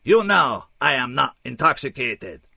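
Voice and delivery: put-on high, loud, oddly accented alien; formal protest in an unplaceable accent; stilted foreign-accented English, pitched high; unplaceable funny accent. A narrator's reading. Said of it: "that funny accent I couldn't place".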